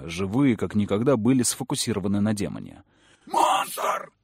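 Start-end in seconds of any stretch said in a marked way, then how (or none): 3.3-4.1 unvoiced startled demon cry; frightened cry. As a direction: cry out in terror. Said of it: "вскрикнул демон".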